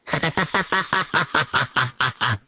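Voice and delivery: mocking laugh. Mother laughing at her son's Valentine's Day boast, mocking laugh. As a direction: laugh at a ridiculous boast in a steady run.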